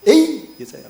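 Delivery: loud, high imitated yell; abrupt mock-furious rebuke; sudden angry outburst. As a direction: act out snapping at someone who pulled you. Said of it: "갑자기 막 그 좀 땡겼다고".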